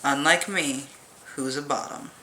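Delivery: usual speaking voice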